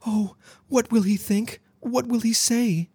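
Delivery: very high, thin mock-fearful voice; fearful whisper. A narrator's reading. Girl exaggerating fear of her partner's reaction, anxious whimper. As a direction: fret in a tiny, high frightened voice. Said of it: "the girl whispered".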